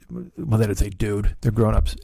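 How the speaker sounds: ordinary talk, nothing marked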